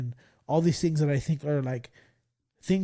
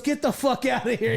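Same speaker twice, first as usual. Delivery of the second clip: retold angry dismissal, higher-pitched; angry teacher; 9 semitones higher, 4 dB louder than usual. Teacher kicks joking student out of class, mock angry. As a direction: imitate a teacher throwing a student out, amused. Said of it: "He got so mad".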